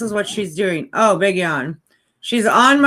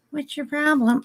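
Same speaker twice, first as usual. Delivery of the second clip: whisper; 5 semitones higher, 4 dB quieter than usual. Real, not acted. Whispering to the puppy.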